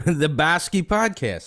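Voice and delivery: put-on a playful stab at a Boston accent. Guest joining the host's joke in a laughing mock Boston accent. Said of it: "a Boston accent to it".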